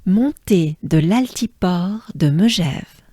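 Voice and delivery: "Montée de l'altiport de Megève" connected, in one flowing phrase; the French way. Shown as a demonstration. Teacher shows learners the French way, connected without breaks. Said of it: "let's do it the French way".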